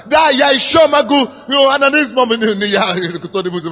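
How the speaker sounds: ordinary delivery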